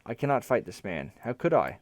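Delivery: ordinary delivery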